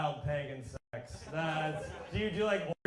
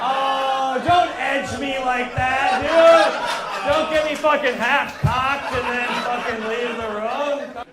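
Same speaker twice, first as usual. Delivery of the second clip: loud, high mock fury; hammed-up angry shout; mock outraged shout; 8 semitones higher, 11 dB louder, slower than usual. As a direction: yell it in fake outrage.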